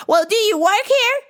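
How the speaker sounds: high, loud nagging plea; whiny customer. Retold or imitated. Pestering the clerk for help, high whiny nag.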